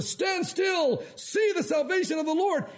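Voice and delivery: high, booming heroic proclamation; hammed-up prophet calling out to a crowd; confident Moses. Moses rallying the people, confident shouted proclamation. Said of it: "He seems so confident".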